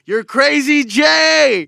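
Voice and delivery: high, drawn-out, very loud yell; hype shout. Real, not acted. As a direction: holler the nickname like a hype man. Repeats new nickname as hype yell.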